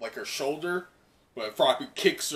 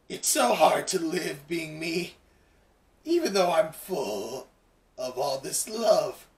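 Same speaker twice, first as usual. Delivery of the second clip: slow, lightly voiced lovesick villain; dreamy Toga; 3 semitones higher, slower than usual. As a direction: draw it out like a twisted love note. Toga's line read in voice.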